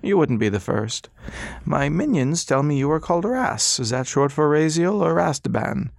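patient, polite, in no hurry; old doctor. Acted. Old doctor to silent Ras, high and courteous.

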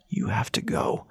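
low, hushed whisper; a quiet, urgent whispered plea. A narrator's reading. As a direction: press her to leave in a whisper. Urgent warning whispered to Callie.

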